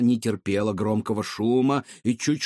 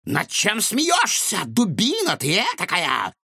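offended mad scientist; 9 semitones higher than usual. A narrator's reading. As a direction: flare up, insulted. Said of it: "вспыхнул доктор".

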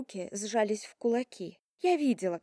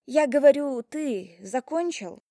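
pointed repeat; 3 semitones higher, 5 dB louder than usual. Acted. Heroine repeats question pointedly.

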